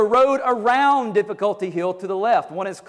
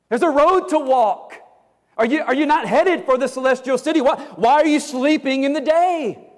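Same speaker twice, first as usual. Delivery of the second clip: higher, louder urging; urgent pleading; 7 semitones higher, 3 dB louder than usual. Acted. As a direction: plead with them to get moving.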